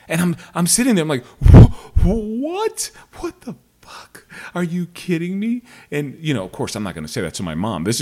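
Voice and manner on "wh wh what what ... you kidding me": much higher, loud disbelief; his first shocked reaction to mom's news; shocked outburst. Retold or imitated. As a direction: explode in stunned disbelief.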